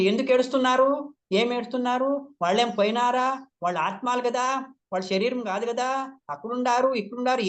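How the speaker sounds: high-pitched mock class lecture; self-important master. Imitating own old class talk to grieving people, self-important high-pitched scolding. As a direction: lightly imitate a know-it-all master chiding mourners. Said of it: "ఏం పెద్దగా master అనుకొని చెప్తుంటే నేను".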